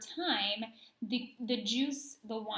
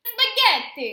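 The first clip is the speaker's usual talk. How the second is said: loud hammed-up Italian accent, high; terrible Italian accent; 10 semitones higher, 13 dB louder, slower than usual. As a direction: shout it like a cartoon Italian chef. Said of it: "You have a terrible Italian accent that you very much enjoy using".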